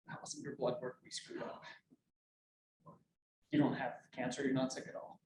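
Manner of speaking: hushed doctor's news. Retold or imitated. Doctor's call retold, hushed.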